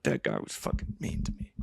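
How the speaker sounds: soft, breathless whimper of hurt feelings; mock tearful whisper. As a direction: whisper it like a wounded child. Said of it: "Tears streaming down my eyes".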